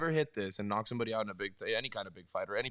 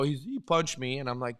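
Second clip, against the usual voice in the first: ordinary talk, nothing marked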